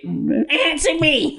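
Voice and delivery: high slow demanding grandmother; grandma voice. Retold or imitated. Grandma presses the boy for a reply, high slow voice. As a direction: demand an answer slowly, high old-lady voice. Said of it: "do her voice".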